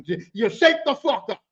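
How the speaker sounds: ordinary talk, nothing marked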